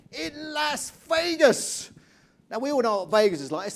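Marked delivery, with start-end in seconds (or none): 0.1-1.9 high, loud, stretched-out announcement; ring announcer. Acted. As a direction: belt it out like a fight announcer. Mimics a fight announcement.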